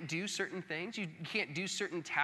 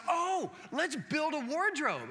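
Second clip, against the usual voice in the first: much higher, slow delighted suggestion; eager dad; 8 semitones higher, slower than usual. Retold or imitated. Dad eagerly proposes building a wardrobe for the book report. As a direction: sell the fun project.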